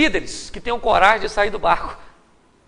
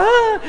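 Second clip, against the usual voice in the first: high short yelp of fright; mock panicked scream; 13 semitones higher, 4 dB louder than usual. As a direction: squeal like a scared crowd. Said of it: "todo mundo começou a gritar". (grito agudo de pânico)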